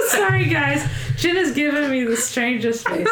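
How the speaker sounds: giggling through an apology; laughing, voice up high. Real, not acted. Cracking up at Jenna's face.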